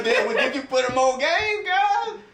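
high laughing voice; laughing, eager to advise; laughing excitedly. Real, not acted.

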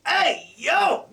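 high, loud goblin call; goblin shout. Acted. Goblin calling to his friend, high shout. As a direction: yell across the room like a goblin.

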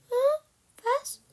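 tiny high, sleepy squeak; high little squeak; squeaky peep. Acted. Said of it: "piepste Blackie".

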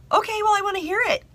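high, quick, keen reply; eager listener. Retold or imitated. Acts her eager answer to bride.

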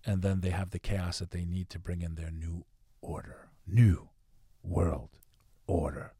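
hushed then swelling dramatic trailer narration; movie trailer voice. Pretend. Joking to Tommy about doing movie voices, ominous trailer read. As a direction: sell the conspiracy like an ominous movie trailer, slow and weighty. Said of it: "I could do the fucking movie things".

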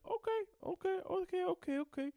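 high, bouncy repeated okays; sing-song it high like cheerful Luffy; goofy high-pitched Luffy brushing it off. Retold or imitated. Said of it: "And Luffy's like".

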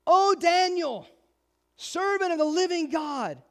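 the king crying out in grief, pitched high; lamentable cry. Acted. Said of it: "he cried with a, uh, lamentable voice".

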